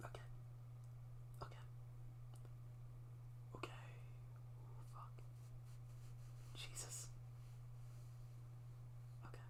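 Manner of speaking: barely voiced cursing through pain; real pain. Real, not acted. Streamer recovering from back spasm, faint pained whisper. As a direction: whisper it on what breath is left. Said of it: "That shit hurts".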